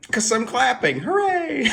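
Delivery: high, loud mock hooray; playful cheer. Clapping and cheering for own show.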